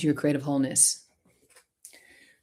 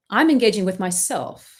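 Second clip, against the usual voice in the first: normal talking voice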